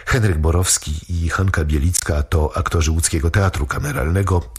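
ordinary delivery

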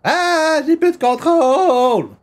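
aigu, fort et traînant, affolé; panique. Acted. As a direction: crie comme s'il perdait pied. Personnage perd le contrôle, panique. (voix paniquée et lente)

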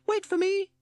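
piping it out again, left behind; lost in the shorts, calling after friends; Toad calling. Acted. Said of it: "called Toad".

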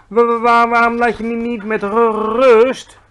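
high, loud, stuttering plea; nervous stammering. A narrator's reading. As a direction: stutter desperately to be left alone. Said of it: "hakkelde hij".

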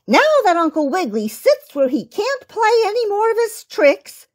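high, quick mouse-teacher voice; storybook mouse, pitched well up; squeaky Lady Mouse. A narrator's reading. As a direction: squeak it like a brisk schoolmistress. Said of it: "squeaked the Lady Mouse".

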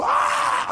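high breathless yelp of fright; a sudden scared gasp; panicked yelp. Retold or imitated. Panic before crashing.

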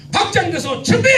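high, loud call to start; loud cue call. Shown as a demonstration. Pastor calls the congregation to start, loud cue. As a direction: bark the cue like a drill leader. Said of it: "지금 연습을 하는 거예요".

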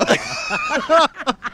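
kahkahayı yüksekten patlat; gülmekten kopar; tiz kahkaha. Real, not acted. Yumruk sahnesine gülüyor. (yüksek perdeden kahkaha)

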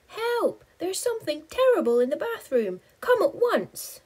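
high, loud, fast panicked plea; panicked high cry. Acted. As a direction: shriek for help in terror. Panicked call for help.

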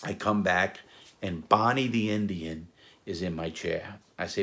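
ordinary talk, nothing marked

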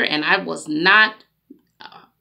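normal talking voice

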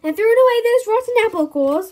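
high, loud frantic mother; fussy mum voice. Acted. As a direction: fuss at him like a harried mum. Mum nagging before viewing.

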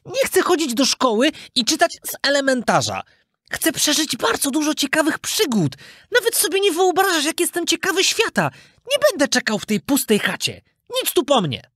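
put-on high, eager, headstrong boy voice; defiant puppet boy. Puppet boy defies the cricket, high and headstrong. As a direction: boast about the adventures ahead.

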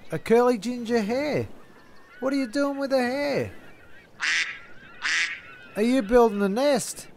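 normal talking voice

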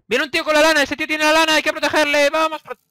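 high, loud battle cry; excited rally. Real, not acted. Gamer rallying teammates to guard the carrier, loud excited shout. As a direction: rouse the team to protect him.

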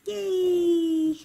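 high, loud yay; a bright, excited toy cheer. Acted. Toys cheer going to park.

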